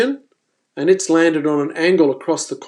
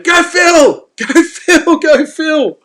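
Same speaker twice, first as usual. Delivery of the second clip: loud, high-pitched cheering shout; excited yell; 12 semitones higher, 7 dB louder, much slower than usual. Retold or imitated. Angler yelling at Phil fighting the mako, excited yell. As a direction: yell it to urge him on. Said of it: "I start yelling out".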